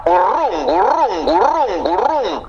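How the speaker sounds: swooping up and down on each repeat; looping high swoops; silly repeated name. Acted. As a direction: whoop each one like a rolling engine. Absurd artist name, chanted repeatedly.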